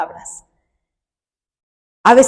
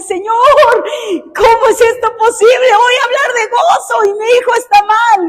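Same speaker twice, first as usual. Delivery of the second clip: retelling a plea to God, pitched much higher; a mother's remembered desperate prayer, higher voice; anguished plea; 10 semitones higher than usual. Retold or imitated. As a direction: cry out to the Lord in anguish.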